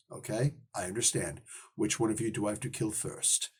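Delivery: quiet menace. Retold or imitated. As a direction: say it soft like a killer's promise. Kingpin turns deadly calm.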